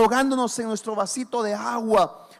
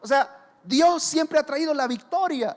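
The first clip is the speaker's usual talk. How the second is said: usual speaking voice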